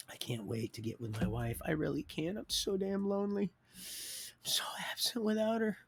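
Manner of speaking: soft, breathy confession of loneliness; longing whisper. Real, not acted. Husband telling viewers he misses his wife, whispering. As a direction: whisper like telling a secret ache.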